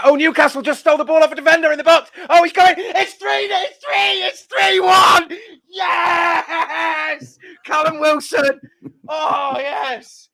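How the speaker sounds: a real fan yelling about a goal as it happens; rapid, high, shouted live commentary; excited goal call. Real, not acted.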